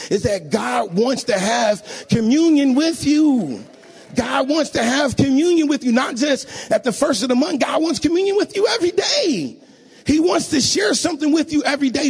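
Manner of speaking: rising, ringing preacher's cry; preaching at full force. Real, not acted. Preacher urging the congregation, rising to full force. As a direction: lift the church with each line.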